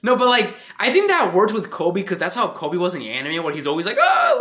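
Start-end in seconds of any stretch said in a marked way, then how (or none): 4.0-4.4 very high, louder mock-panicked cry; anxious yelp. Imitating the always anxious anime Kobe, high anxious yelp. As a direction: imitate a nervous character's squeal. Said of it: "like, just always anxious".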